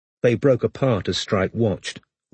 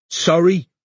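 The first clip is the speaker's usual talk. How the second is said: gruff; 8 semitones higher, 4 dB louder than usual. Acted. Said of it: "he said gruffly".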